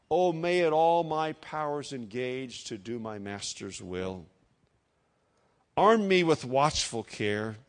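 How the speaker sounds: slow, solemn verse reading with long pauses; hymn recitation. Acted. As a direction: lift each opening phrase, let the line ending fall. Recites old hymn text.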